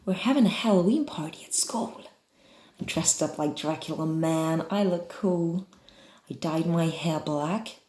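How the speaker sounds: put-on American accent. Shown as a demonstration. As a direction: read it out as an American. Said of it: "let's try American".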